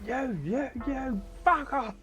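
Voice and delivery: high excited shout; excited yell. Real, not acted. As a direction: yell at the screen. Excited gaming exclamation.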